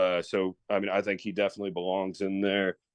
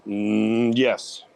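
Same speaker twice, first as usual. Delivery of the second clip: drawn-out, louder "mm" before committing; hesitant yes; 6 dB louder than usual. Real, not acted. Guest answers a yes-or-no Hall of Fame question, hesitating first. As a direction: think aloud, then give a firm answer. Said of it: "you had to think about it".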